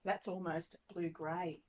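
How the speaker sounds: normal talking voice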